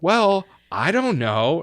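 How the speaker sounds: imitated huffy grandma, high and loud; huffy retort. Grandmother retorts in retold argument.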